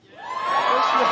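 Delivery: a sudden high excited yell; squealing with nostalgic glee; excited shout. Real, not acted. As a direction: burst out with joy at the memory.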